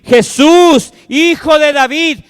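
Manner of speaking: high, loud desperate cry; shouting beggar. A narrator's reading. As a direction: call out over the crowd. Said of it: "comenzó a gritar y decir".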